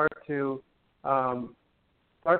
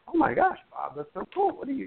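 mock-thrilled squeal, much higher; playfully imitating a thrilled friend receiving a gift; delighted gushing; 8 semitones higher than usual. Teacher imitating a student delighted by a gift, high mock squeal. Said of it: "you go".

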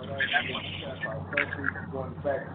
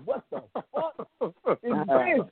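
high, drawn-out disbelief; incredulous outburst; 8 semitones higher, slower than usual. Retold or imitated. Teller reliving his shock at the red and blue, incredulous. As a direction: retell your shock, pitched way up and slow.

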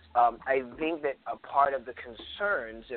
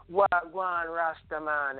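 slow, higher mock-Jamaican greeting; fake Jamaican accent; 5 semitones higher, slower than usual. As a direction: imitate a kid's put-on Jamaican accent. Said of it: "fake Jamaican accent".

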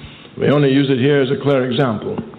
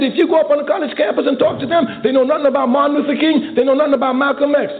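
high-pitched mock old man, insistent; imitating elderly man; 10 semitones higher, faster than usual. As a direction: squeak out the old man's complaint.

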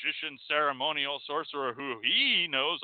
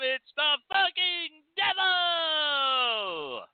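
high, slow, shouting about the devil; 7 semitones higher than usual. Retold or imitated. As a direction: preach it like a hellfire zealot. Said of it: "The Christians go".

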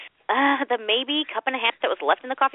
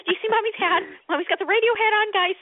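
put-on mom to kids; 7 semitones higher than usual. Mom voiced addressing her kids.

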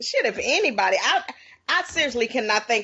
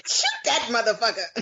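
laughing; 6 semitones higher than usual. Real, not acted. Cracking up at the joke.

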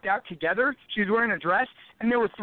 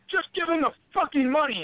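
higher, urgent coach; coach urging; 7 semitones higher than usual. Retold or imitated. Coach telling him to give up the money, urgent and higher. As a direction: press him to hand it over. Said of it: "my coach was like".

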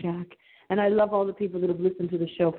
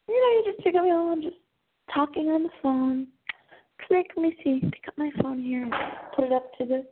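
acted phone chat; 7 semitones higher, faster than usual. Acts out picking up phone.

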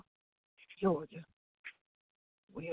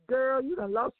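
sassy purse; 5 semitones higher, 4 dB louder than usual. Retold or imitated. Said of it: "what my purse was saying".

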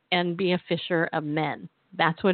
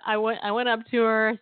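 normal talking voice

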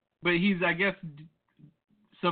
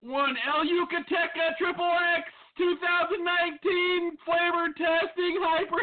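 hyped intro; 10 semitones higher than usual. Real, not acted. Hyped countdown intro to tasting.

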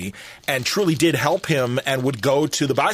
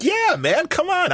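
higher, mock-pleading come on; half-hearted exasperation, pitched up; retold exasperated plea; slower than usual. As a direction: lightly replay nudging stubborn fans. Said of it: "I'm like".

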